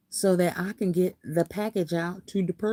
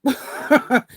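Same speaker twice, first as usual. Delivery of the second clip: laughing out loud, then a loud laughing stop it; a real burst of laughter while scolding; 6 semitones higher, 6 dB louder than usual. Real, not acted. Laughing about troll kids to her live viewers, loud laughing scold.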